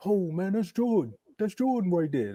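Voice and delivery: a loose take on Kevin Garnett, awed by Jordan; a hint of Kevin Garnett meeting his idol; Kevin Garnett impression. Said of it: "That's my Kevin Garnett accent".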